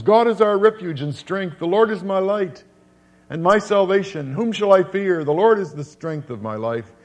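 proclaimed scripture. Acted. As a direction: declaim the psalm to the congregation.

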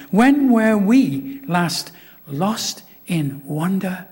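very slow, drawn-out question; slow wonder. Acted. Preacher asking the church, slow and awed. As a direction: linger on each word in awe.

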